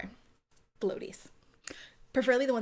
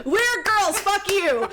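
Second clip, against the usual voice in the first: defiant outburst; 8 semitones higher, 6 dB louder, slower than usual. Real, not acted. Defiant aside to the audience.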